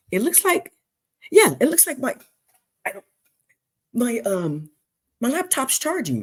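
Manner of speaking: high, puzzled discovery; suddenly noticing a problem; surprised. Real, not acted. Checking broken stream on air.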